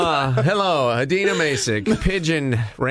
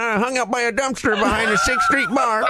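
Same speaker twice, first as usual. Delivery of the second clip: lightly higher scruffy pigeon voice; 5 semitones higher than usual. Pretend. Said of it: "this is Bielko coming for his breakfast".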